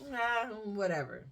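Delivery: ordinary talk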